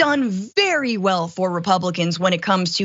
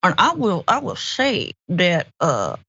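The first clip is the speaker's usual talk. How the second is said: Mitch McConnell. Acted. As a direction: drag out key words in a hammed-up senator impression. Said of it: "I could do a very good Mitch McConnell".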